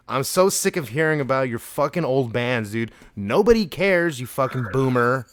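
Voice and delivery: normal talking voice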